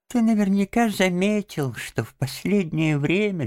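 high, thin invalid's babble; feeble babbling. A narrator's reading. Sick Toad on his decline, feeble babbling. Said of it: "лепетал Жаби".

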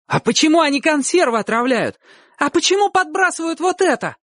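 very high, indignant clamour; clamouring boys. A narrator's reading. Boys arguing back at the uncle, high-pitched clamour. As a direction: pipe up high like protesting boys. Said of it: "загалдели ребята".

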